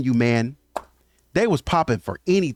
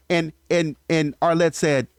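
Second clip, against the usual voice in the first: ordinary talk, nothing marked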